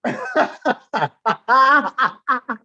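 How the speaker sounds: loud high laughter; bursting into laughter; real laugh. Real, not acted. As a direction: crack up loudly. Laughing hard.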